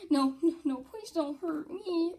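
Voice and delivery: high pretend begging, slowing at the end; frightened pleading. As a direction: plead for mercy in play. Victim begs Gold for mercy.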